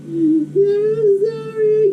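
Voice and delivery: high, whiny fake crying; mock tearful apology. Boy apologizing while watching the older sibling, mock tearful. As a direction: imitate a boy blubbering sorry.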